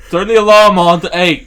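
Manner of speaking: shouted, high Cockney order; mock Cockney. Joking Brit ordering Alexa about, shouted mock Cockney. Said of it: "the Cockney accents of some British people".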